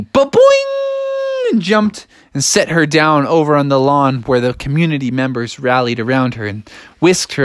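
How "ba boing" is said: very high, ringing boing; springy boing. Acted. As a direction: launch it like a giant spring. Imitates jump.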